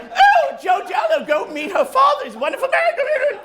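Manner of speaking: high, shouted sugary granny voice; Missus Doubtfire mom. Retold or imitated. Mom sends the boyfriend to meet her father, Doubtfire-style. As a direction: call him over like Mrs. Doubtfire. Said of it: "she sounds like Missus Doubtfire".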